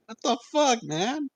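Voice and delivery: high, loud startled outburst; startled yell. Retold or imitated. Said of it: "I was like".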